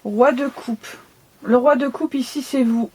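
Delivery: usual speaking voice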